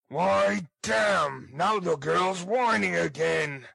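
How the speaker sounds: loud, high-pitched angry outburst; brutish, exasperated shout; exasperated bully. Acted. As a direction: curse in irritation as a ruffian. Sikes curses the whining girl.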